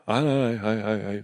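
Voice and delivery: imitated grumpy nej-nej-nej; grumpy muttering. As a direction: mimic a driver grumbling to himself. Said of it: "Sitter han och muttrar och är grinig".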